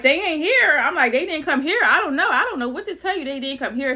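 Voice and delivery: high and loud, insisting they aren't here; frantic denial. Retold or imitated. To the panicking dad on the phone, flustered high insistence. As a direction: rush it out, flustered and defensive.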